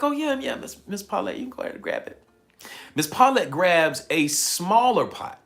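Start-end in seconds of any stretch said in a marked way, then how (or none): 0.0-2.2 eager agreement. Retold or imitated. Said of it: "I'm like".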